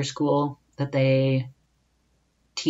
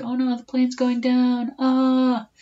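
mock panic; 11 semitones higher than usual. Imagining his plane crashing over Costa Rica, hammed-up high panic. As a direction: play it as a hammed-up scream on a falling plane.

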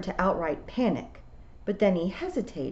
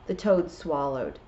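usual speaking voice

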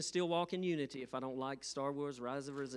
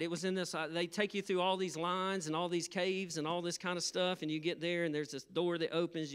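ordinary delivery

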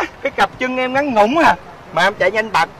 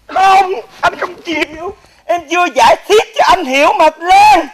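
high, loud, desperate plea; desperate calling out; 5 semitones higher, 5 dB louder than usual. Acted. Lover begging Lan to wait, desperate high shouting. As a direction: shout after her as she leaves.

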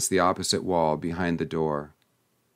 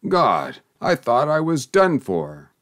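raucous groan; 4 semitones higher, 3 dB louder than usual. A narrator's reading. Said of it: "raucous and strange, groaned out".

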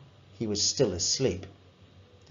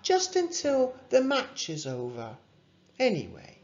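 higher, lightly voiced gentle mum; 12 semitones higher than usual. A narrator's reading. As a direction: coax him kindly to rest. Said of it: "said his mum".

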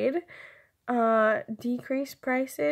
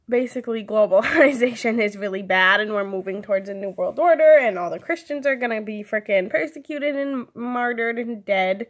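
louder, driven conviction; emphatic rant; 8 dB louder than usual. Real, not acted. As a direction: push the point hard with conviction.

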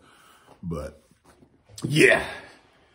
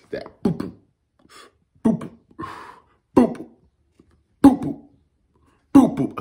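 boop boop rising louder; punch sounds; 9 semitones higher, 4 dB louder than usual. Retold or imitated. Vlogger imitating Hart's punches, rising boop sounds. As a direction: mimic a flurry of blows.

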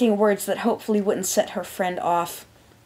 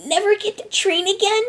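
high, halting, stammered question; pitched-up, broken, tearful voice; on the verge of sobbing; 4 dB louder than usual. A narrator's reading. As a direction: falter as tears come on. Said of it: "She began to sob".

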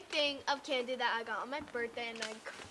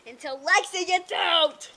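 loud taunt; 4 semitones higher, 14 dB louder than usual. Real, not acted. Competitive taunt at sister.

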